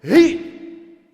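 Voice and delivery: long, loud, high angry cry; furious exclamation. Acted. The king storms out in anger with a loud drawn-out cry. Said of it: "왕이 매우 화가 나서".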